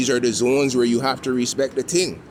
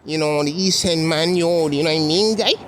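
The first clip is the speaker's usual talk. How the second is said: higher-pitched mimicry of Toronto East End slang; East End accent; 5 semitones higher than usual. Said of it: "was an East End accent".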